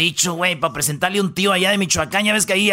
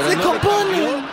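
"Se componen" said higher through a laugh; giggling "Se componen", pitched up; laughing mock granny; 7 semitones higher, slower than usual. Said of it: "lo más bonito es al último".

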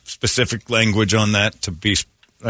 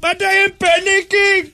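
put-on much higher, squeaky Indian accent for the trapped man; mock Indian accent; 4 dB louder than usual. Trapped passenger to crew, much higher mock Indian accent. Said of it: "in that voice".

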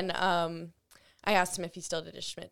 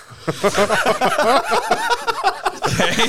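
big high laugh; loud burst of laughter; 10 semitones higher, 13 dB louder than usual. Real, not acted. Big laugh at hockey take.

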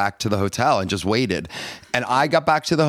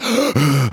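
panicked gasping; 3 semitones higher than usual. Retold or imitated. Father retelling his panic at the hotel, gasping. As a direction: gasp as if unable to calm down. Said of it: "I still could not calm down".

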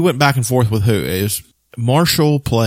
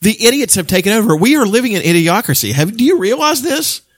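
higher, worked-up rant; exasperated rant; 10 semitones higher than usual. Real, not acted. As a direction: rant with disbelief. Ranting about idiots.

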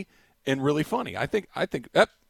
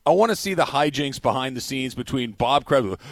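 ordinary delivery

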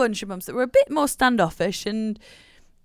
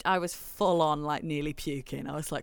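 usual speaking voice

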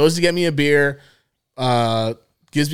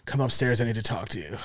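soft, lowered imitation of a mother; low stern mom; 4 semitones lower, 9 dB quieter than usual. Mom summoning son after catching him, low and stern. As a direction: drop it into an ominous quiet summons.

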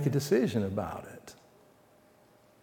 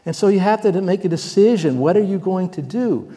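louder, higher urgent call; preaching; 6 semitones higher, 7 dB louder than usual. Real, not acted. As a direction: press them to decide. Sermon call to decide.

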